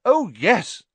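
high, loud forced yes; overdone, false delight; lying enthusiasm. Acted. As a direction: agree too keenly. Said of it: "they lied".

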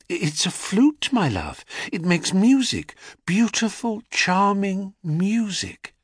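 high, gentle and patient, slowing on charming music; patient mother. A narrator's reading. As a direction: savour the beauty of the music for him. Mother explains the flute.

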